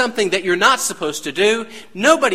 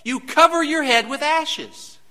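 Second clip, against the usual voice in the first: higher, louder preacher's cry; preaching at full force; 7 semitones higher, 4 dB louder, slower than usual. Acted. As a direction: build the sermon to a peak. Preaching build, raised voice.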